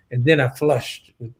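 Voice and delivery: ordinary talk, nothing marked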